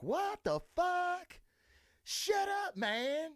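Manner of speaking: hammed-up Southern yokel outcry; mock Southern disbelief. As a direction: friends putting on Southern accents at a bar, mock disbelief. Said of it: "we were being all fucking Southern".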